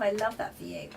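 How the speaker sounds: ordinary delivery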